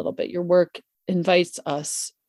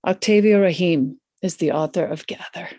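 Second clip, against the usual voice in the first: ordinary talk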